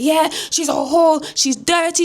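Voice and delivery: high, slow mock African accent; granddad's taunt in fake African accent. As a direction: put on an African accent for the insult. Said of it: "saying it in this fake yard".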